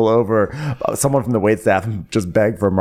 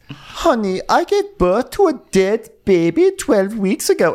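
high, slow put-on voice; mock indignant Diana; 12 semitones higher, slower than usual. Diana silencing Sutton with her loss, mock indignant voice. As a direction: draw it out in hammed-up grievance. Acted quarrel, Diana retorting.

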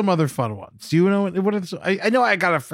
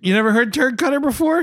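high-pitched disbelief; can't believe they never heard it; incredulous; 9 semitones higher than usual. Real, not acted. Incredulous at friend not knowing.

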